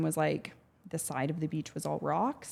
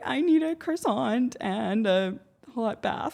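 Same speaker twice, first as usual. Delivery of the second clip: higher, slow, pampered whine; mock whiny plea; 6 semitones higher, 4 dB louder, slower than usual. Herself after nearly drowning, mock whiny plea for comfort. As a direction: whine it like a spoiled princess. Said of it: "I was like".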